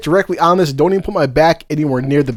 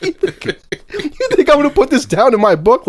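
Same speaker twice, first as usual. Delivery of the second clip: laughing disbelief; 9 semitones higher than usual. Retold or imitated. Mocking the question.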